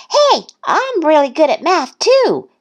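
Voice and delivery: high, excited little-duck outburst; exclaimed. A narrator's reading. Thistle boasting about math, high excited exclamation. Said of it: "exclaimed Thistle".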